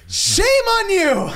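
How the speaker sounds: high stretched scolding cry; mock yell. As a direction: belt it out like a scolding friend. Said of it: "He just yelled".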